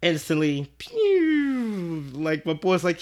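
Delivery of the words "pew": high falling "pew" whistle; deflating sound effect. Acted. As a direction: imitate something dropping fast. Imitates instant deflation.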